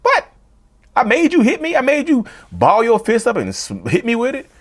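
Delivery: high indignant disbelief; outraged disbelief. Real, not acted. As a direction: throw it back in disbelief.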